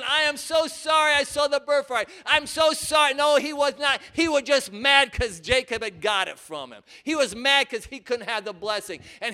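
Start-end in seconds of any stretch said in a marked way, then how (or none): 0.0-3.1 shouted mock apology; mock sorry. Preacher mocking a fake repentance, shouted mock apology. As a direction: overplay the sorry. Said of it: "He was not looking".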